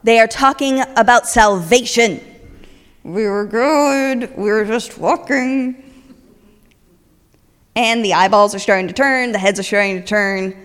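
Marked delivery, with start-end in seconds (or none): 0.0-2.2 loud, slow announcement; hammed-up shouting possessed woman; screaming woman. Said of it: "the woman starts screaming". 3.0-5.8 slow, innocent protest; lightly voiced sheepish Paul; sheepish reply. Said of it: "Paul answering the screaming woman".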